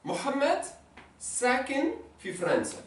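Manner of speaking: sakin fi France slowed and spaced; slow model sentence. Shown as a demonstration. Teacher dictating new sentence slowly. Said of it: "on peut mettre fi France".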